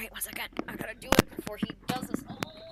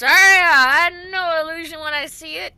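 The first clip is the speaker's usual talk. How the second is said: gruff accusation; 6 semitones higher, 12 dB louder than usual. Acted. Old man accuses illusionists.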